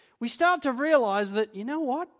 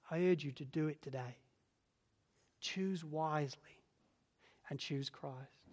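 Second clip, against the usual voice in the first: soft earnest appeal; 5 semitones lower, 11 dB quieter than usual. Real, not acted. Closing appeal to listeners.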